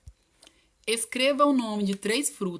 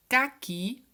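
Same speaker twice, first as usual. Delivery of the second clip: caqui raised and emphatic, strong final qui; higher-pitched caqui, weight at the end; stress on last syllable; 5 semitones higher, 4 dB louder than usual. Shown as a demonstration. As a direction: dictate caqui leaning on the qui. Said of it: "tem que ser a última sílaba mais forte".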